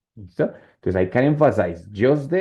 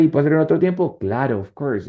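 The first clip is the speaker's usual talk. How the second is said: ordinary talk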